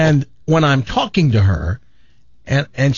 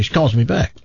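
normal talking voice

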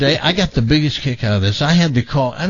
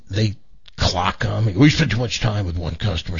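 ordinary delivery

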